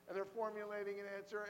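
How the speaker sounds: normal talking voice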